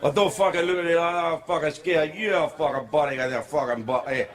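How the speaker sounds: put-on garbled, thick Scottish accent. Comic mimics a Scot to the crowd, thick Scottish accent. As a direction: run the words together in broad Scottish. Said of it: "this is a joke about Scotland".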